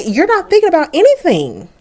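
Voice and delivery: high, exasperated mock scolding; mildly hammed-up exasperated mother; scolding mom. Mom scolds son in quarrel.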